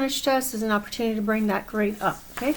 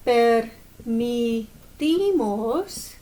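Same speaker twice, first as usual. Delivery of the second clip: slow dictation; much slower than usual. Shown as a demonstration.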